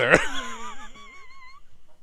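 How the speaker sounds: high villainous cackle; evil cackle. Acted.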